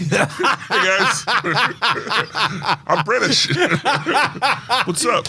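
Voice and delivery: put-on a terrible British accent through laughter; mock British accent. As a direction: ham up a British accent while cracking up. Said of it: "it was bloody awful".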